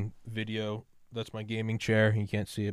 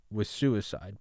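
usual speaking voice

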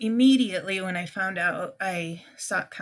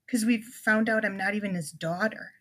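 ordinary delivery

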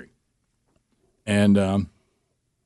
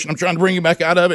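usual speaking voice

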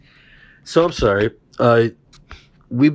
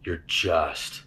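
reassuring friend; 6 semitones lower than usual. Retold or imitated. Friend sitting by the bed, soothing him in a low voice. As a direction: talk him down gently.

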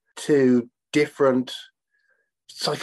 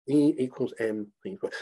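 quiet repeat; 6 dB quieter than usual. Shown as a demonstration.

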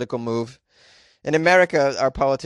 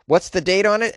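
ordinary delivery

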